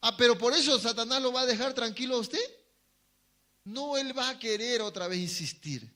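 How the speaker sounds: ordinary delivery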